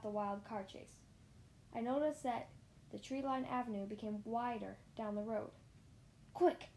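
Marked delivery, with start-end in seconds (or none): 6.3-6.7 high, loud urgent shout; sudden high-pitched call. A narrator's reading. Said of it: "I shouted to the driver".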